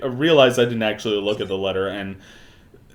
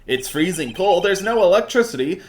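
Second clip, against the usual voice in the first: high, whiny protesting character voice; Larry; 8 semitones higher than usual. Acted. Game character protests about the shack, whiny. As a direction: complain indignantly, pitched well up. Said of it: "doing the Larry voice".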